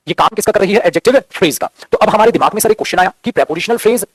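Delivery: ordinary talk, nothing marked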